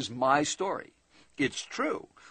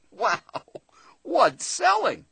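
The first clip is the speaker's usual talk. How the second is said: disbelief; 6 semitones higher, 4 dB louder, much slower than usual. Real, not acted.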